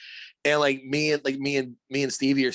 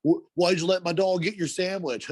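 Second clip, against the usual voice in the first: higher imitation of an owner's challenge; lightly voiced dog owner; 6 semitones higher, 3 dB louder than usual. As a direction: question it as the owner, lightly mocked. Voicing owner quizzing the customer.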